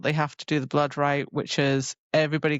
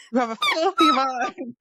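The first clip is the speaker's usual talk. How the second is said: much higher mock voice teasing; mocking tease; 14 semitones higher, 3 dB louder than usual. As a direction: squeak it out like a prissy tease. Mocking voice for Nesta.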